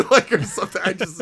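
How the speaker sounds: laughing through the words; words spilling out with a laugh. Real, not acted. Laughing at own joke.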